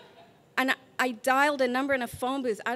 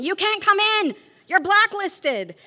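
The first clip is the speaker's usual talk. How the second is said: 1 alarmed shout; 9 semitones higher, 10 dB louder than usual. Retold or imitated. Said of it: "the teacher's backing away from the door going".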